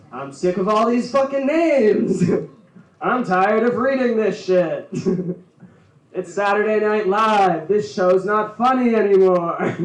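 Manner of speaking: loud, slow, ranting announcer fed up; announcer meltdown. Retold or imitated. Announcer snapping on air, mock ranting meltdown. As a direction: rant like a fried announcer losing it. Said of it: "the announcer guy having a meltdown".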